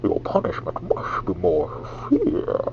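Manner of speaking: whispery masked villain; Bane. Shown as a demonstration. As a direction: demonstrate a villain voice, all breath. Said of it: "let me try that again".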